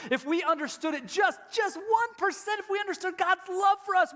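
very high, loud impassioned plea; impassioned climax of the sermon; preaching climax. Acted. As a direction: plead it at the top of your voice.

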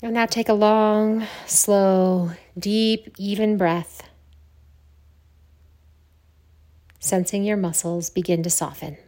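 measured meditation voice with long silences; guided meditation. Acted. Yoga teacher opening a Yoga Nidra practice, guided meditation. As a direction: guide them calmly into stillness, letting phrases sink.

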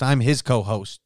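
ordinary delivery

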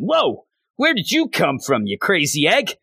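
high-pitched startled outburst, acted; mock startled. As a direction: act taken aback, voice jumping up high. Acted startled reaction in retelling.

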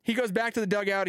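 ordinary talk, nothing marked

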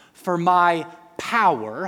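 power said loud and slow; stress on power. Shown as a demonstration. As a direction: lean hard on "power". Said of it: "highlight that word".

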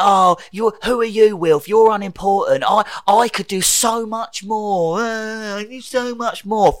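high, loud, self-pitying mock rant; Tenth Doctor. Mocking the Doctor's rant at unimportant Wilf, whiny tantrum. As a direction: whine it out in exaggerated protest, dragging the complaint. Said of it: "ranting and raving about how it's not fair".

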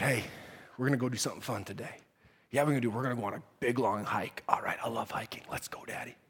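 hushed, very soft and low; whispering a secret. Retold or imitated. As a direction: whisper like sneaking out at dawn. Father and son sneaking off.

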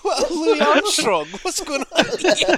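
high, loud, slow laughing question. Real, not acted. Laughing at the wop noises.

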